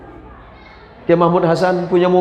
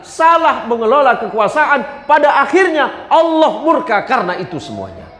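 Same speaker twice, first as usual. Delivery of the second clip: fiery preaching; 8 semitones higher than usual. Real, not acted. Preacher warning the congregation against quarrels, building to a fiery cry. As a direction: preach it harder with each line.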